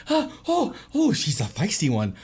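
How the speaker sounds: high moans broken by a remark; comic sore, squeaky groaning; pained Moo. Acted. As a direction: moan in falsetto between words. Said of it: "and Moo said".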